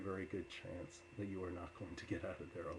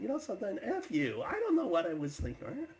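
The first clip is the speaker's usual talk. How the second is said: loud, high-pitched angry retort; acted indignant yelling; upset; 13 semitones higher, 9 dB louder than usual. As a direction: fire back, shouting in offence. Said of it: "he started getting upset with me".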